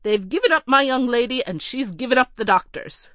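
a haughty butler reeling off a list of refusals; curt butler. Acted. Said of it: "continued the butler calmly".

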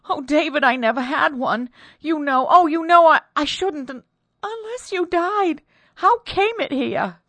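high, loud, stammering plea; frightened wife. Acted. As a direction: she can barely get it out. Wife pleads, veil not hers.

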